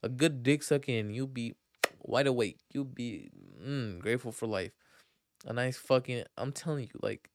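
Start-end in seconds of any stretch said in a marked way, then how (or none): none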